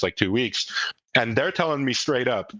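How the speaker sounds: usual speaking voice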